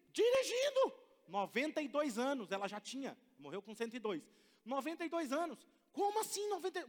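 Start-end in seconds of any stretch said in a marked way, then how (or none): 0.1-0.9 Dirigindo! shot up very high and loud; astonished exclaim. Real, not acted. Preacher amazed that a ninety-two-year-old drove herself. 5.9-6.8 much higher disbelieving question; incredulous. Real, not acted. Preacher marveling to the congregation at her age.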